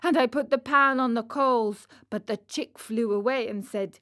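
ordinary delivery, nothing marked

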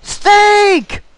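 high excited shout; excited yell. Real, not acted. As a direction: blurt it out loud. Joke answer.

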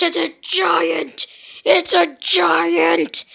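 scared shout. Acted. Character spots giant.

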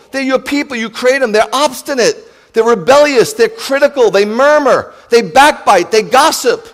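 loud, rising fervent preaching; impassioned pulpit cry; preaching at full force. Acted. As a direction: thunder it out to the congregation. List of sins, preaching.

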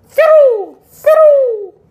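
very high, loud drawn-out squeal; excited squeal. Real, not acted.

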